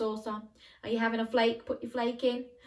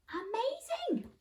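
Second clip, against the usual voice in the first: amazed; 4 dB quieter, slower than usual. Real, not acted. Praising the children's castle.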